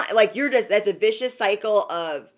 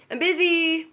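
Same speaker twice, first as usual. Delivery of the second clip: high, sing-song brush-off; lightly mock a busy, dismissive Libra; 8 semitones higher than usual. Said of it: "And you're like".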